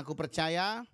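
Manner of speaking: preacher dictating a prayer for the church to repeat; declaration prayer. Shown as a demonstration. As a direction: lead the room in a faith declaration, phrase by phrase.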